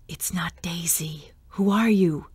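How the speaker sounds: a hissed, menacing whisper; threatening whisper. A narrator's reading. Ghost whispering a threat to Jason, menacing whisper. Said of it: "The sibilants were full of threat".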